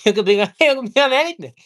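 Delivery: very high, fast, loud laughing taunt; laughing mockery. Real, not acted. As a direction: laugh it out in disbelief. Mocking people with a laugh.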